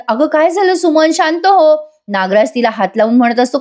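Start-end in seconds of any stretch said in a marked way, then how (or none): 0.1-1.8 higher-pitched, mildly alarmed concern; retold alarm. Aunt tries to calm upset Suman, mildly alarmed. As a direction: imitate a worried aunt raising her pitch. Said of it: "त्या घाबरून म्हणतात".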